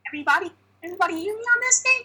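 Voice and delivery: high, fast cartoon bear voice; Greg Ayers voice. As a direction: rattle it off high and quick. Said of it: "you being Greg Ayers".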